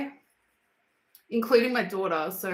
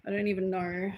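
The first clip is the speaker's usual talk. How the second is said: ordinary talk, nothing marked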